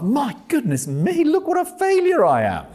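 high, dismayed wail of a discouraged evangelist; self-pitying Micah. Retold or imitated. Micah despairing to himself after a failed outreach, hammed-up lament. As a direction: play it as a hammed-up, sorry-for-himself sigh. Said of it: "Micah will be saying to himself".

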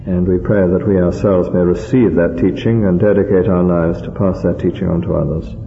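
low, measured praying voice; prayer. Real, not acted. Preacher thanks the Heavenly Father, in low, reverent prayer. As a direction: lead a reverent closing prayer, pitched low and even.

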